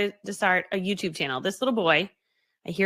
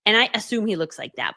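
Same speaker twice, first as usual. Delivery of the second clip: ordinary talk, nothing marked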